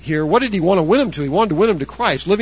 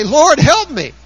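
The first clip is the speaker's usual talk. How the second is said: higher and louder, calling out to God; pleading prayer; 9 semitones higher, 7 dB louder, slower than usual. Retold or imitated. Preacher voicing a believer's plea, raised and earnest. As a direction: cry out for help like a prayer.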